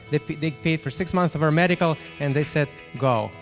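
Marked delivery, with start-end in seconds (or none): none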